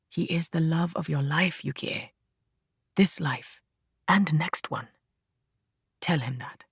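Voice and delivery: soft, hushed mother's voice; mother in a vision. A narrator's reading. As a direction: speak softly, like a voice from a vision.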